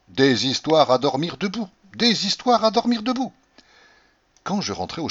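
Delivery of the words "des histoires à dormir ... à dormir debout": repeated grumble, rising much higher the second time; grumbling into moustache. A narrator's reading. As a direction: uncle grumbling to himself, voiced aloud rather than muffled. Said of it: "répétant dans sa moustache". (ronchonnement dans sa moustache, de plus en plus haut)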